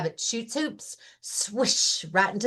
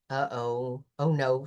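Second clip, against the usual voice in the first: a hint of a dismayed Lion; 8 semitones lower, 5 dB quieter than usual.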